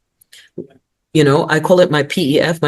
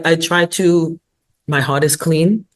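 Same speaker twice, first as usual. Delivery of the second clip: ordinary talk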